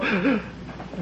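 a short breathless gasp; demonstrated gasp. Shown as a demonstration. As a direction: catch the breath as if sobbing.

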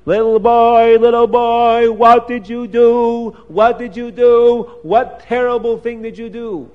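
high, loud chanting cry of horror; screaming accusation. Acted. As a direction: wail the accusation on one high pitch. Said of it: "and screaming".

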